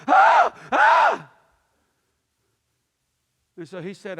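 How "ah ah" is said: two piercing high, loud screams; panicked screams. Retold or imitated. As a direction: scream helplessly in imitation of a panicker. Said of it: "she's out there on the floating dock going".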